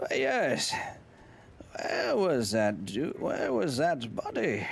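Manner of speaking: put-on Aussie drawl with swooping pitch; Australian accent. Streamer hamming it up for chat in an Australian accent. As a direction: play it up in a broad Australian accent. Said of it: "when you do the Australian accent".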